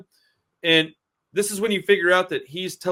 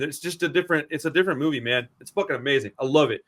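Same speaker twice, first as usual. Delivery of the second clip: ordinary talk, nothing marked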